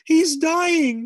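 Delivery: high, loud panicked cry; alarmed shout. A narrator's reading. Mother shouts to the family that father is dying, high and loud. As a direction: shout it in terror for him. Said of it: "she shouted".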